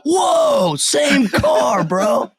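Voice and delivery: high, loud, amazed surfer dude; Keanu Reeves. Retold or imitated. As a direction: exclaim it like an awestruck slacker. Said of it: "like, old school Keanu Reeves".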